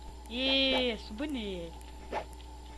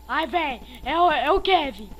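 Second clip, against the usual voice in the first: excited; 3 semitones higher, 11 dB louder than usual. Real, not acted. Spotting the killer in game.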